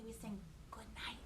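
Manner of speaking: soft unvoiced whisper. Real, not acted. Teacher whispering to the class before the goodnight song. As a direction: keep it hushed for bedtime. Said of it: "sing it kinda quietly".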